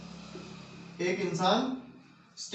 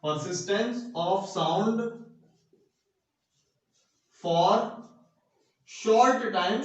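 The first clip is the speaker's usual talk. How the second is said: very slow, word by word, for note-taking; slow dictation; 2 semitones lower, much slower than usual. Shown as a demonstration. Teacher dictating a definition to students, slowly word by word. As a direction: spell the definition out so students can write it.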